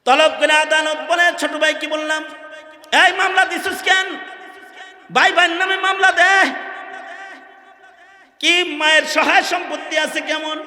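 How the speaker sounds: high, loud declamation of a courtroom tale; high-pitched pulpit storytelling; impassioned preaching. Acted. Preaching at full force.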